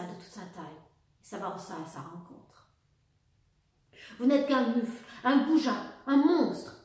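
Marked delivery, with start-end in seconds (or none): 4.2-6.7 loud, raised furious insults; furious shout. A narrator's reading. Baroness screams insults at the officer, furious shout. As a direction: hurl the insults at him. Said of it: "hurla Sophie".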